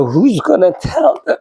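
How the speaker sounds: high, pleading question; voice of Jesus. Retold or imitated. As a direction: ask it like a divine call. Voicing Jesus's call.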